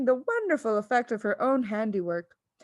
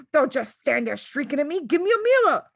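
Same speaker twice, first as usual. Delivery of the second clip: rising to a high, louder yell; 5 semitones higher, 6 dB louder than usual. A narrator's reading. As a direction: yell the demand in panic. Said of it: "the father yelled".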